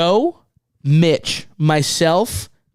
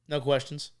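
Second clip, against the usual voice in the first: hushed curt reply; 2 semitones lower, 10 dB quieter than usual. Retold or imitated. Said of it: "And he just goes".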